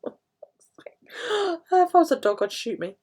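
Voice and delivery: high, slow laugh-crying through the words. Real, not acted. Joking to viewers about her sore feet, laughing. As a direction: laugh it out near tears.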